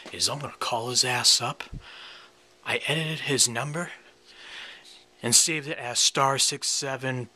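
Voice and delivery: usual speaking voice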